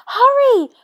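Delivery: high, loud urging; urgent call. A narrator's reading. Moana urges her friends to hurry. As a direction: push everyone to hurry. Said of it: "urged Moana".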